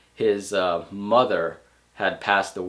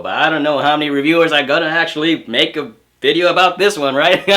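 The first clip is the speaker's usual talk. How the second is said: a light, badly faked Texan twang, slightly higher; terrible Texan accent; 5 semitones higher, 7 dB louder than usual. Reviewer joking about Texas movie, bad Texan accent. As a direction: ham up a weak Texan accent. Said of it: "That was a terrible Texan accent".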